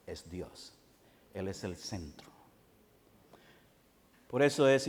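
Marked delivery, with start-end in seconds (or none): 0.1-2.3 much lower, far softer solemn words; hushed emphasis. Acted. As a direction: sink the voice to a solemn hush. Drops voice for emphasis. (voz muy baja y solemne)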